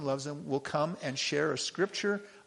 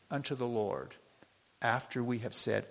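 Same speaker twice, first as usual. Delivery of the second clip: normal talking voice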